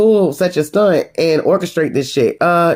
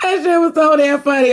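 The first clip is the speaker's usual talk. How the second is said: laughing hard; 9 semitones higher than usual. Real, not acted.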